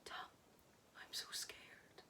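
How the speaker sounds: hushed, slow, frightened whisper through tears; tearful whisper. Acted. Kate, crying, tells Tom she is frightened: slow tearful whisper. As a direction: breathe the words out, scared and crying. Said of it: "She begins to cry uncontrollably".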